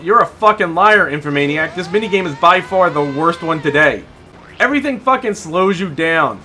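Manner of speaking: usual speaking voice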